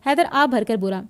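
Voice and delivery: ordinary delivery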